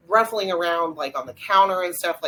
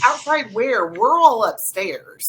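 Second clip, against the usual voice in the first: higher, louder imitation of a baffled reply; retold bafflement; 6 semitones higher, 6 dB louder, slower than usual. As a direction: mimic a puzzled caller, stretching the ending. Retold puzzled phone reply.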